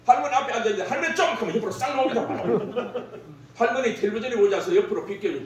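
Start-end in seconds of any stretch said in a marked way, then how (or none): 0.9-1.3 higher, blunt Gyeongsang call of 할매 좀; curt dialect call. Retold or imitated. Grandson asking grandma to move aside, blunt high call. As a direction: bark it short at grandma. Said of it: "딱 경상도 세 자로 끝납니다".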